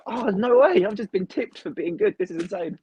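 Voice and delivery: high, loud retold amazement; amazed. Wrestler retells a fan tipping him at merch, thrilled and amazed. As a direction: blurt it like you can't believe your luck. Retelling surprise at being tipped.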